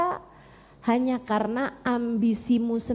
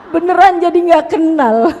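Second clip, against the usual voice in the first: laughing loudly; 4 semitones higher, 12 dB louder than usual. Real, not acted. Punchline after laugh.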